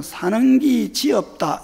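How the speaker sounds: slow Gyeongsang dialect version. Shown as a demonstration. Pastor demonstrating dialect to congregation, Gyeongsang accent. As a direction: say it the Gyeongsang way, drawn out. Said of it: "그냥 경상도 말로는".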